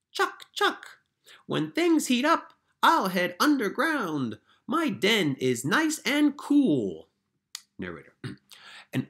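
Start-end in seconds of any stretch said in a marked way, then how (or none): 0.1-1.0 squeaky high woodchuck chatter; woodchuck noise. Pretend. Teacher voicing the woodchuck for her class, high and squeaky. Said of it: "change your voice for the characters". 1.5-7.1 higher, louder critter voice; woodchuck character. Pretend. Teacher reading the woodchuck's part for students, higher and louder. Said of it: "change your voice for the characters".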